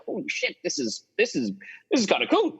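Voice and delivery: sudden high squeak of amazement, then settling; amazed disbelief. Retold or imitated. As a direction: blurt it out like you can't believe your luck. Stunned at getting published.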